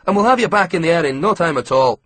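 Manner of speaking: chirpy Scottish mechanic handing over a receipt; Scottish accent. A narrator's reading. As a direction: lift the pitch with a Scottish lilt. Scottish ground worker talking.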